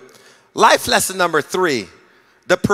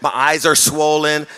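ordinary delivery

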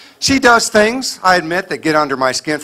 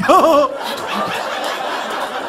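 high sheepish giggle; 10 semitones higher, 4 dB quieter than usual. Pretend. Husband's sheepish laugh.

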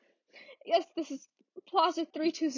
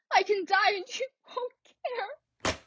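tearful; 7 semitones higher than usual. Acted. Crying to operator.

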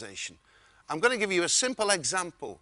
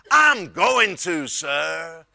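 loud, slow, drawn-out Texan reply; booming Texan; 8 dB louder, much slower than usual. Retold or imitated. Wayne overheard outside the door, slow booming Texan impression. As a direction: stretch it out big for a customer. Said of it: "I heard a voice just outside the door going".

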